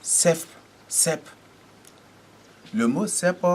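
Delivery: cep said sharp, with an s sound and no f at the end; Sar word cep. Shown as a demonstration. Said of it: "on dit cep".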